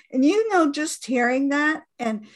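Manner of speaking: ordinary talk, nothing marked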